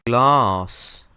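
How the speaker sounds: very loud, drawn-out call; announcer call. Real, not acted. Host naming the next dimension for viewers, booming announcement. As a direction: belt out the title.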